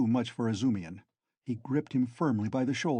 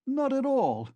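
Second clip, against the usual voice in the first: high, flustered outburst; embarrassed cry; 13 semitones higher, 4 dB louder than usual. Acted. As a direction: blurt it out, caught off guard. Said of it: "cried Zametov, obviously embarrassed".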